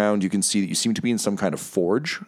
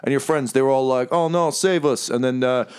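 high-pitched, pleased fiend chattering away; high casual demon host, repeating himself happily; chipper fiend; 7 semitones higher than usual. Acted. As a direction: play a fiend delighted with his own work. Game master plays fiend Bell.

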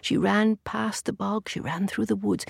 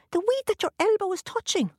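leprechaun; 13 semitones higher than usual. A narrator's reading. Leprechaun answers.

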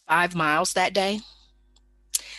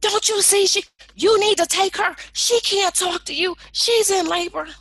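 high, loud demanding yell; pushy yelling friend; 6 dB louder than usual. Retold or imitated. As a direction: demand the nurse act now, loudly. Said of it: "starts going in on her again".

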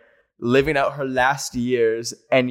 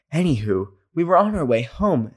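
ordinary talk

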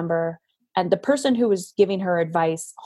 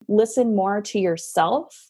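ordinary talk, nothing marked

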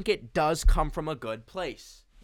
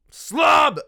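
comic self-mocking yell; yelled punchline; 15 dB louder than usual. Real, not acted. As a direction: shout it high and loud.

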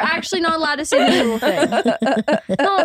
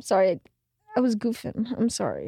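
reluctant sorry, dropping softer and lower; mumbled apology; 3 semitones lower, 6 dB quieter than usual. Real, not acted. Younger sister apologizing to older sister, choked and mumbled. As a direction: swallow the words of the apology. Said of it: "Why'd you choke on your words?".

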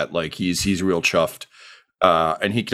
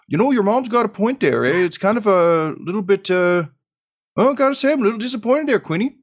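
high, folksy dad voice with an eh; a jovial farmer dad, much higher; 13 semitones higher, 3 dB louder than usual. Acted. Dad teases his son about the missing friends. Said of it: "And Ford's like".

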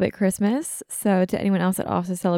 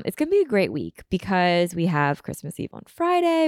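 ordinary talk, nothing marked

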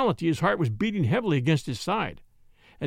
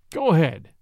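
sulky; 5 dB louder than usual. A narrator's reading. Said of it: "he said sulkily".

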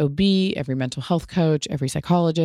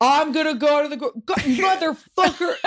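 high, laughing mock of dad's dictation; imitating dad; 10 semitones higher than usual. Son imitating dad dictating a text and swearing, high. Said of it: "he would be like".

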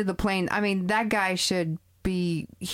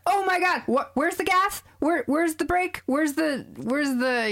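higher, frantic mock pilot scrambling for controls; hammed-up panic, much higher; 7 semitones higher than usual. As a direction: play a flustered amateur flying a plane.

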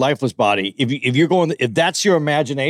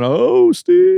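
mock pained whine; 4 dB louder than usual. Mock whine of beaten man.

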